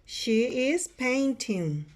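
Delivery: slow, separated words; model sentence for repeating. Shown as a demonstration. Teacher repeats the sentence for students, slow spaced delivery. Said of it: "Repeat after me".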